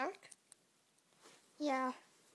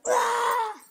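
high, loud yelp of pain; hurt yelp; 9 semitones higher, 12 dB louder than usual. Pretend. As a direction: yelp as if struck. Running away, gets hit.

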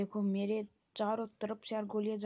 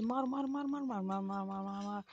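urgent repeated urging. Real, not acted. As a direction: egg the fighter on, word after word. Urging on attack in game.

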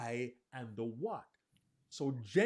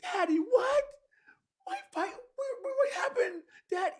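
put-on much higher, crying, near-shouted child's voice; a little boy breaking into tears; almost crying child; 6 dB louder than usual. Shown as a demonstration. Little boy hearing his dog is dead, near tears, high and crying. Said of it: "My voice tells you I'm almost crying".

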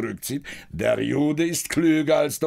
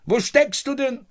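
calling out; 7 semitones higher than usual. Acted. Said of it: "Sie kommt heraus".

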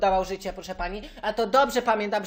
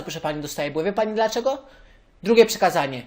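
usual speaking voice

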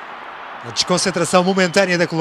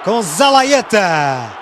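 raised, animated play-by-play; excited commentary; 7 semitones higher, slower than usual. Real, not acted. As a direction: keep the goal excitement going. Post-goal commentary.

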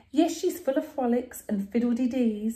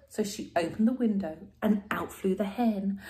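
plain reading voice

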